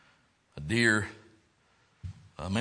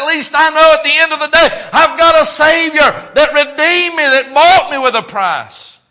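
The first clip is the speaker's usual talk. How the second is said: loud, driving sermon delivery, higher pitched; full-force preaching; 14 semitones higher, 17 dB louder than usual. Acted. Pastor preaching hardship to the church, full force. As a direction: preach it hard to the congregation.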